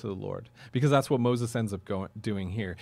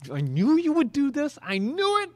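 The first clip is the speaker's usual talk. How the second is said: high, rushed exasperation at God; frustrated prayer; 11 semitones higher, 5 dB louder than usual. Retold or imitated. Pastor voicing an angry believer to God, frustrated and higher. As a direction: blurt it out like a sulking child. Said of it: "you're just like".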